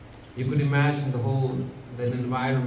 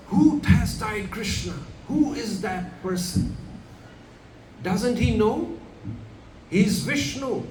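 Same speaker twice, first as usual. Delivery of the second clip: raised, slow, higher indignant roar of Balaram; Balaram's outrage; 6 semitones higher, slower than usual. Retold or imitated. Balaram raging at whoever tied Krishna, booming and slow. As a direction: boom out Balaram's divine fury. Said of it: "he said".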